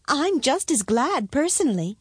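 high princess voice. Acted. Princess admits she is glad, high-voiced. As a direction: pipe up in a high voice.

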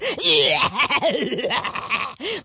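big booming dragon laugh; roaring laugh. A narrator's reading. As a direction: roar with helpless laughter. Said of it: "Laughed the dragon".

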